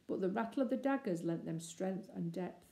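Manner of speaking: usual speaking voice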